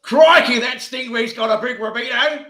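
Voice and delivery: excited, high, loud mock Aussie accent; Steve Irwin. Podcaster doing Aussie on co-host's request, hammed-up Australian accent. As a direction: ham up a shouted Australian accent. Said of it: "put on your Aussie accent".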